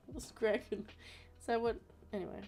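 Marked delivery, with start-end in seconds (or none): 0.1-0.9 slow, failed Aussie attempt with a laugh; half-hearted Aussie catchphrase; bad Australian accent. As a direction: laugh through a badly faked Australian accent. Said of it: "I'm terrible at them".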